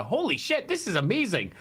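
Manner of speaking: excited pitch jump on holy shit; sudden delighted amazement; amazed exclamation. Retold or imitated. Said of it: "you're suddenly left with a".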